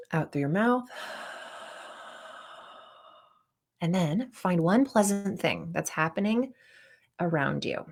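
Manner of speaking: soft guided-relaxation voice with long pauses; guided breathing exercise. Shown as a demonstration. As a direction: lead them gently through a breath, unhurried and hushed. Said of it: "Okay, so try that with me".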